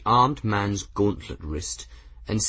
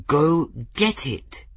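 slow, higher, forced through teeth; through clenched teeth; 7 semitones higher, slower than usual. A narrator's reading. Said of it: "said through clenched teeth".

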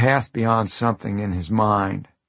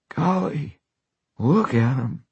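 high, marvelling golly; wonder; 5 semitones higher, slower than usual. Acted. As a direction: marvel like a boy. Dying man marvels at flies.

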